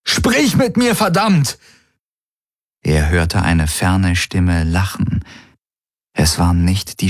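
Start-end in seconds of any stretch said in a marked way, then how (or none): 0.1-1.6 much higher, Sprich hurled out; screaming at the silent voice inside him; desperate yell. A narrator's reading. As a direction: demand an answer, shouting. Keeps shouting at the helix.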